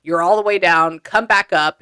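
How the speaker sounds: loud, firm mock command from the spirits; spirits pushing you up. Spirits hauling a grieving listener up, loud insistent call. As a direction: order them back up, loud and firm. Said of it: "They're like".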